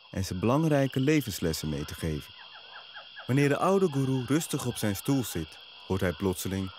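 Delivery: ordinary delivery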